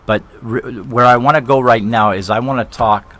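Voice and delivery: ordinary delivery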